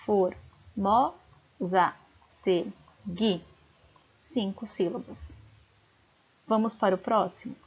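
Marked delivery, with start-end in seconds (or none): 0.1-3.4 very slow, separated syllables of "Formosa se gui"; counting syllables. Shown as a demonstration. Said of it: "Vamos contar".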